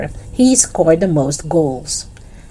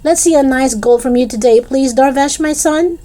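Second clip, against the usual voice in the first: calling out; 9 semitones higher than usual. Acted. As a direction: shout it across the pitch. Mum calling from the sideline.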